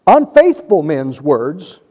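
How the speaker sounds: normal talking voice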